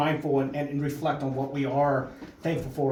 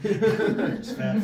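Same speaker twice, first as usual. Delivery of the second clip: laughing; 6 semitones higher than usual. Acted. Host joking about being fat.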